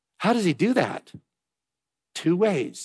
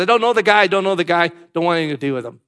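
Peter's frantic denial; 6 dB louder, faster than usual. Acted. Peter denying Jesus by the fire, a loud panicked denial. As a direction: push him away in panic.

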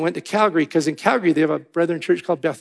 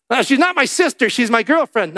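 higher, louder mock objection; acted protest; 6 semitones higher, 5 dB louder than usual. Young man correcting the usher about his girlfriend, mock loud protest. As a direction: blurt it out as a retold protest.

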